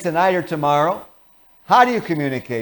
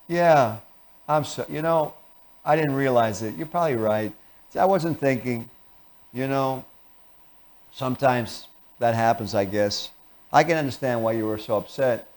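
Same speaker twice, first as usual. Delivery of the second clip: lower, much softer mumble; bored; 4 semitones lower, 5 dB quieter than usual. Shown as a demonstration. Bored spouse conceding to the angry partner. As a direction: give in without any energy. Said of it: "you could also use boredom".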